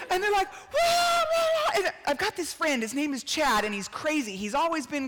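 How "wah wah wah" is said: high shouted wah-wah cheers; mock wild cheering cry. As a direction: wail like a frenzied parent in the stands. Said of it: "they turn into rabid human beings".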